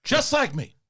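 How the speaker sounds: Pacino rant. Acted. Pacino rant bit continues.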